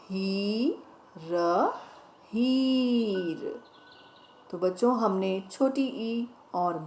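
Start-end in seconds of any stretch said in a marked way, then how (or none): 0.0-3.6 slowly stretched word. Shown as a demonstration. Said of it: "ह में बड़ी ई की मात्रा".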